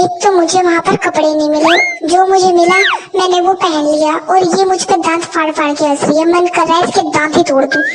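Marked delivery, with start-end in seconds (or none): none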